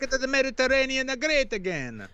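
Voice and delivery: high, hammy Italian voice; mock Italian accent. Son rallies for the Mediterranean, mock Italian accent. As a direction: boast like a cartoon Italian.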